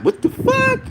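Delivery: a very high, disbelieving squeal; shocked at something dumb, voice shooting way up; incredulous outburst. Retold or imitated. Fan reacting to a boxer's dumb quote, incredulous squeal. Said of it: "I'm like".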